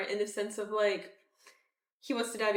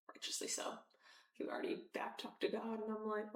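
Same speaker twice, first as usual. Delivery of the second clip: whispered aside; 12 dB quieter than usual. Real, not acted. Quiet aside about Jonah.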